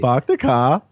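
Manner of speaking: Boston accent that comes out Indian; badly faked Boston accent with an Indian tinge; attempted Boston accent. Shown as a demonstration. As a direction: try Boston and land in New Delhi. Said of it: "that's an Indian that lives in Boston".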